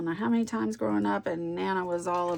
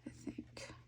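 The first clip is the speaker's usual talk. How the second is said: whisper; 21 dB quieter than usual. Real, not acted. Whispered afterthought.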